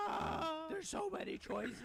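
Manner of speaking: put-on high, awed kid voice, slow; a kid overwhelmed by the options; amazed little kid. Mimicking a mind-blown kid seeing all the choices, high and awed.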